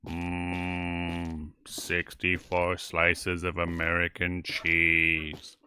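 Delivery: slow, low, dreamy food musings; hint of a hungry glutton; savoring mmm voice. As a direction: drool over each food.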